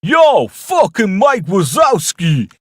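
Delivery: loud, high, stretched Brooklyn yell; hammed-up Brooklyn holler; Brooklyn shout. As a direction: bellow it in a Brooklyn accent. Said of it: "shouting him in a Brooklyn accent".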